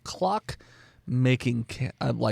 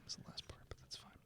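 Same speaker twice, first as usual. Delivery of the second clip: ordinary talk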